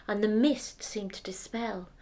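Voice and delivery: plain reading voice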